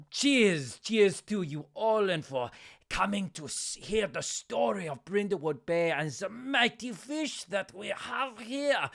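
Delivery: put-on high, grand foreign-accented toast; fishmonger showman. Fishmonger toasting tourists before his tale, grand showman. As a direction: hail the crowd like a storyteller. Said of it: "and he goes".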